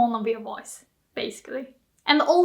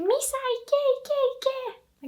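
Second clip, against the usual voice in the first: high excited cheer for a runner; 13 semitones higher, 4 dB quieter than usual. Pretend. Friend cheering a marathon runner, high excited shouts. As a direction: cheer Misa on from the roadside.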